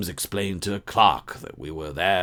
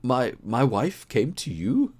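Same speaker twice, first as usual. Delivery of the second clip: hoarse; 8 semitones higher than usual. Acted. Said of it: "he asked in a hoarse voice".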